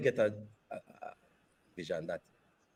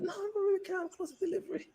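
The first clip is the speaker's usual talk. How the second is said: much higher, lightly voiced team member; a hint of a squeaky refusal; mock high refusal.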